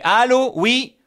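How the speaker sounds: loud fake hello on the phone; fake phone answer. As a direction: pretend a call just came in. Faking a phone call.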